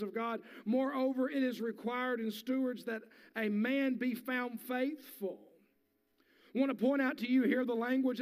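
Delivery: loud, high, driving sermon voice; full-force preaching. Real, not acted. Pastor preaching at full force to his congregation.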